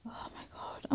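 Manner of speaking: barely voiced, spent sigh of words; whispered exasperation. Real, not acted. Tired of the mistreatment, sighing it out in a whisper. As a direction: breathe it out, worn down. Overwhelmed venting to her friend.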